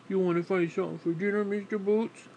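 excited coo at the dog; pet talk. Real, not acted.

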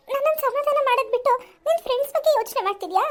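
ordinary delivery

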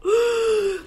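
high, loud pretend gasp; big storybook gasp; mock gasp. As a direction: gasp in fake alarm. Reacting to the wolf.

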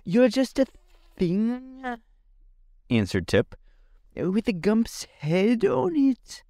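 put-on a young boy explaining plainly; high youthful voice; boy Tip. A narrator's reading.

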